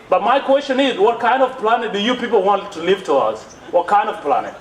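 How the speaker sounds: ordinary delivery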